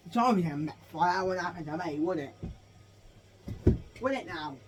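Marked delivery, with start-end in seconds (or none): none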